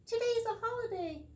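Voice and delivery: high voice used by mistake; a too-high take she then corrects; wrong voice. Acted. Reader slips into the leprechaun's high voice for Tom. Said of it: "No, wait, that's not the right voice".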